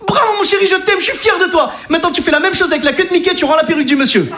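high gushing praise; proud excited dad. Retold or imitated. Father gushing at his son after the catch, high and excited. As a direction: cheer him on, high and gushing.

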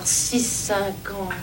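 très lent, bas et étiré; compte la monnaie. Acted. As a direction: compter les pièces à mi-voix, syllabe par syllabe. Pharmacienne compte la petite monnaie. (comptage lent et bas de la monnaie)

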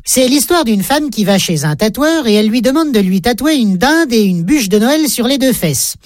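ordinary talk, nothing marked

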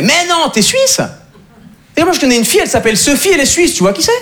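high-pitched amazed Frenchman; excited disbelief. Acted. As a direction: act out a stranger's eager surprise. Acts a thrilled Frenchman he met.

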